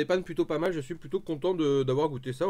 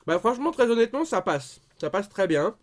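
talking between bites; chewing while talking; 4 semitones higher, 4 dB louder than usual. Real, not acted. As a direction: mouth partly full of food. Seen 3: Bites kebab at -2 to -1, then talks with mouth working.

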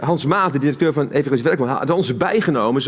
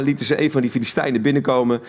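normal talking voice

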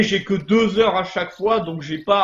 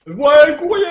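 high, loud mail-call cry; 9 semitones higher, 9 dB louder than usual. Acted. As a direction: sing out the delivery like a carrier. Game's mail carrier calling out.

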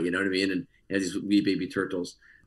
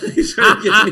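high, loud, laughing through the words; 12 semitones higher, 11 dB louder than usual. Real, not acted. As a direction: crack up at your own punchline. Cracks up at own punchline.

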